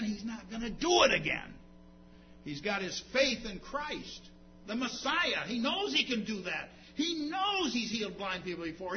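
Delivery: driving sermon delivery; impassioned preaching. Real, not acted. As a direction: preach it with conviction, punching key words. Preaching at full force.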